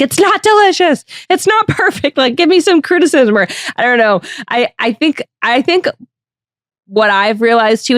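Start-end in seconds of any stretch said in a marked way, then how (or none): 0.0-3.4 high-pitched mock outburst of frustration; exasperated wife. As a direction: imitate a partner driven crazy, pitched way up. Voicing the wife going insane.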